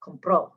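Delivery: "Compró" stressed on the final ó; stress on accented vowel. Shown as a demonstration. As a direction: model "Compró" with the weight on PRÓ. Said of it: "that's where you want to put your emphasis".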